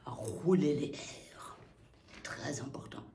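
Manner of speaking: breathy whisper aside. Real, not acted. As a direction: tell them in a whisper. Aside to students.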